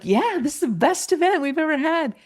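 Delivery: high, emphatic cheer; enthusiastic agreement. Retold or imitated. Speaker agrees with everyone at Bob's event, enthusiastically. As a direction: agree with lifted, swooping enthusiasm.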